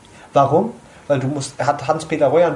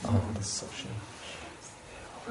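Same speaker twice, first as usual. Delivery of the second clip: dreamy whisper; 7 semitones lower, 16 dB quieter, much slower than usual. Real, not acted. Swooning over a favourite song.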